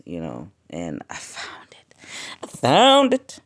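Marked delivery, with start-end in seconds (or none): 1.1-1.9 soft whispered "found it". Real, not acted. Podcaster revealing she found peace, whispering. 2.4-3.4 "found" shouted high and loud; triumphant shout. Real, not acted. Podcaster celebrating she found peace, shouting.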